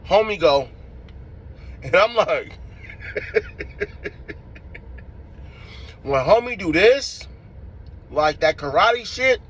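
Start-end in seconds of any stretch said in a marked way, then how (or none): 2.6-6.0 high breathy laugh. Real, not acted. Laughing while telling the fight.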